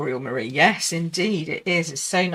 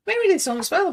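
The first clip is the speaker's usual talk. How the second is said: excited; 13 semitones higher, 5 dB louder, faster than usual. Real, not acted. Spotting a viewer join.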